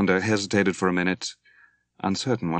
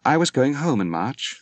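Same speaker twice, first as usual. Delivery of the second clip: higher, louder exclamation; exclaiming; 7 semitones higher, 4 dB louder than usual. A narrator's reading. Moira tells Dwight her lost plans, exclaiming louder and higher. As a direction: burst out with it. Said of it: "she exclaimed".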